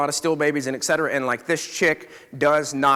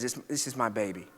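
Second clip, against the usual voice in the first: retold sobbing; 6 semitones lower, 10 dB quieter than usual.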